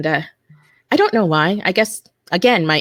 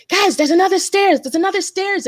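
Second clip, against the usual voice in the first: high urgent call to the group; 14 semitones higher, 3 dB louder than usual. Retold or imitated. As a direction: call them to the stairs. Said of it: "I ran back and I'm like".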